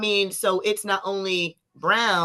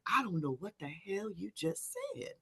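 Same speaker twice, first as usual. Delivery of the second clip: baffled mutter; 18 dB quieter than usual. Retold or imitated. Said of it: "the guy was like".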